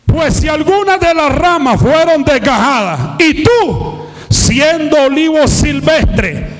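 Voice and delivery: ringing pulpit proclamation; fervent preaching. Real, not acted. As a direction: proclaim it phrase by phrase with fervor. Preaches scripture with force.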